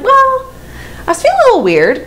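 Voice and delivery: very high, squeaky hedging; retold coy confession; sheepish admission. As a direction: pitch it way up, caught out.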